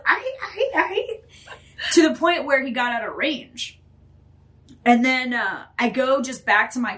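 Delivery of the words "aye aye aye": high yelps of a shocked dog; dog yelping. Retold or imitated. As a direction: yip it out high and sharp. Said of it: "all you hear down the fucking trail is".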